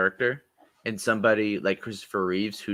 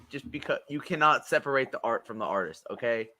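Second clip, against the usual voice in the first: ordinary talk, nothing marked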